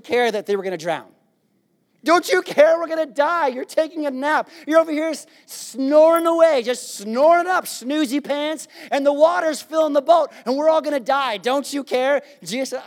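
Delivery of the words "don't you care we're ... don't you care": high, loud mock-panic rant; hammed-up alarm at a napping teacher; playfully frantic disciples yelling; panicked disciples. Disciples panic at sleeping Jesus.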